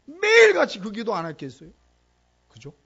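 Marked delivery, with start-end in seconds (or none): 0.1-1.7 loud exclamation. Acted. Preacher's peak of indignation.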